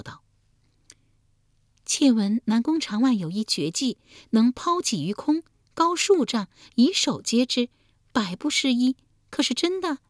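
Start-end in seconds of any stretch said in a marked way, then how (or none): 1.9-10.0 much higher voice for the favoured concubine; a high-pitched, curious courtly lady; royal concubine's voice. Acted.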